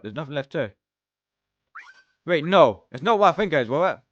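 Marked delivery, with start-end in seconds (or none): 2.3-4.0 sudden alarm, higher and louder. Acted. Realizing the friends will sacrifice themselves, alarmed. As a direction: catch on to the danger all at once.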